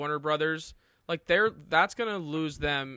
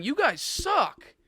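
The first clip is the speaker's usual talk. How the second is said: mock director voice; 9 semitones higher, 3 dB louder, much slower than usual. Acting out Nolan quitting.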